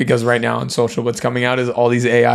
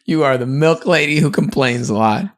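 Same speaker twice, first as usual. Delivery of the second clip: higher, teasing echo of wife's line; mock repeat; 6 semitones higher, 4 dB louder than usual. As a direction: tease her by echoing it back. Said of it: "repeating wife's line".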